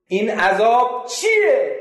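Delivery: high, loud, slow question; loud demand. Retold or imitated. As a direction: call out the question. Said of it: "narrator asks again". (با صدای بلند و کشیده)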